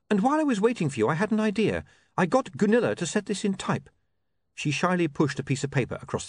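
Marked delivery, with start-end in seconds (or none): 0.1-3.9 high, keen explanation; eager woman reporter. Acted. Saccharissa showing William her idea, eager. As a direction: present your idea hopefully.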